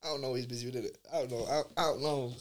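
ordinary delivery

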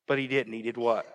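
ordinary talk, nothing marked

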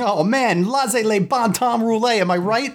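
high, hammy Cajun Italian; hammed-up accent. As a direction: ham up a Cajun toast.

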